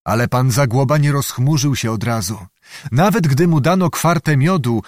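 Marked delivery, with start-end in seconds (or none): none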